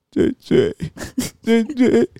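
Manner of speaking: very high, whimpering babble; imitating toddler. 模仿孩子. (高音模仿小孩委屈地念)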